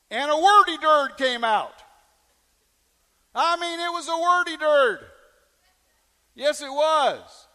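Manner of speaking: high, loud comic emphasis; loud, high-pitched storytelling punchline; emphatic exclamation. Real, not acted. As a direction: belt it out to the congregation for effect. Comic emphasis on the swearing incident.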